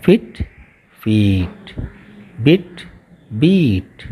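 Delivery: short i in फिट, long ee in फीट, contrasted pair by pair; long and short vowels. Shown as a demonstration. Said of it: "इसको देखिए".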